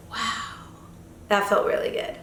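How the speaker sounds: a soft, breathy near-whisper of relief; hushed relief. Retold or imitated. Remembering calm in the ocean, softly whispered relief. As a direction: let the wonder out under your breath. Relief after linking with teammate.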